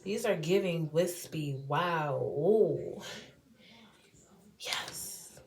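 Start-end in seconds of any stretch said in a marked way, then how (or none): 4.6-5.4 whisper. Real, not acted. Quiet delight.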